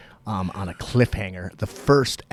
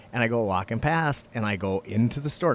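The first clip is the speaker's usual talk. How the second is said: ordinary talk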